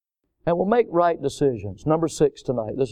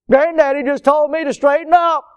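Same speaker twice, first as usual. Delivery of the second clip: put-on much higher, louder complaining child's voice; whiny kid; 10 semitones higher, 5 dB louder than usual. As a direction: tattle like a startled grandkid. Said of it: "he used a different voice".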